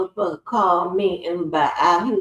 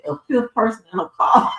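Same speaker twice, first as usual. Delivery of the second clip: higher, laugh-filled delivery; 5 semitones higher, 3 dB louder than usual. Real, not acted. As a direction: voice lifting high into laughter. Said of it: "I'm laughing because".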